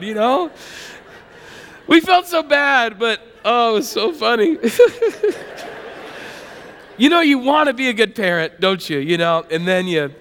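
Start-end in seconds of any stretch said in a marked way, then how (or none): 4.6-6.3 breathy chuckle at his own story; real chuckle; amused laugh. Real, not acted. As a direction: laugh helplessly after the punchline.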